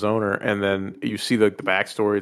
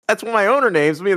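high innocent dog; 10 semitones higher than usual. Retold or imitated. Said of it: "He goes".

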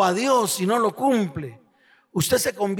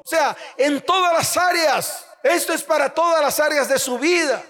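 raised, driving sermon voice; fervent preaching; 5 semitones higher than usual. Acted. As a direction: push the warning out to the congregation. (predicación fervorosa, voz alzada)

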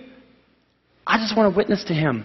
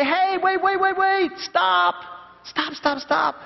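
high urgent calls to stop, pitched way up; calling out; 12 semitones higher, much slower than usual. Pretend. Camper warns friend walking toward a ravine, calling out high. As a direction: warn a friend heading for a ravine. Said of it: "you're gonna call out".

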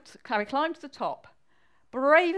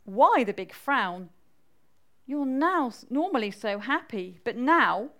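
lightly voiced wife, natural pitch, louder; 4 dB louder than usual. Wife asks Harry about his frown, lightly voiced and louder. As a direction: press the question in a natural register. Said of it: "asked his wife".

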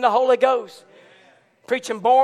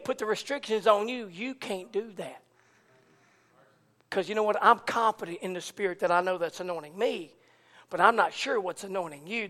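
hushed aside; 5 semitones lower, 8 dB quieter than usual. Acted. Dropped voice, confiding passage.

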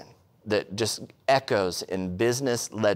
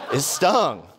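laughing; 3 semitones higher, 4 dB louder than usual. Real, not acted. Laughing at the memory.

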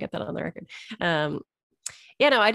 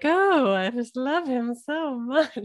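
higher, very slow, swooning mock crush; lovestruck Lisa; 7 semitones higher, much slower than usual. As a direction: gush it like a smitten girl, hammed-up.

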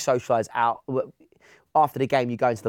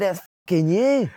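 high-pitched mock-Scottish accusation; put-on Scottish accent, pitched up; 7 semitones higher, 4 dB louder than usual. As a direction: imitate the exasperated Scottish manager. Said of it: "That's a good accent".

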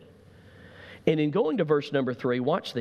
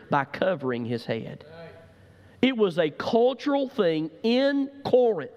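preacher painting first-century Corinth; preaching; 5 semitones higher than usual. Acted. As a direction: build the picture of pagan Corinth.

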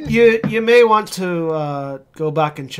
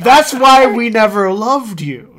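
slow, louder, higher cold parent; 4 semitones higher, 7 dB louder, slower than usual. Acted. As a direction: deliver it like a heartless parent. GM voices the player's parents.

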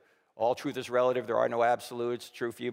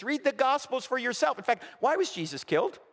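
ordinary talk

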